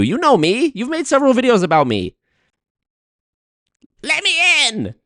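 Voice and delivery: high, pleading indignation; mock incensed. Creator demanding a debate spot, mock incensed. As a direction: demand to be included, climbing to a hammed-up cry. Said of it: "I feel actually personally incensed".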